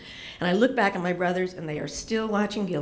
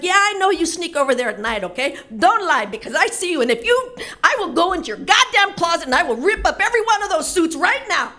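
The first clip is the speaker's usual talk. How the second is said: high, loud mock threat; angry mom threat; 8 semitones higher, 7 dB louder than usual. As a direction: tear into the husband, hammed up.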